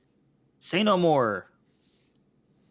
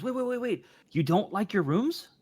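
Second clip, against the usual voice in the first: alarmed call; 8 semitones higher than usual. Acted. GM voices tavern keeper calling.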